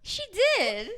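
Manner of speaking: high, loud confirmation; teasing, emphatic high voice. Real, not acted.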